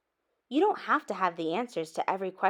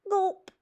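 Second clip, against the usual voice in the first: nervous gulp; 11 semitones higher than usual. A narrator's reading.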